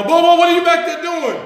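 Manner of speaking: high, loud suspicious call; a grandma hollering from the other room. Retold or imitated. Grandma calling from front room.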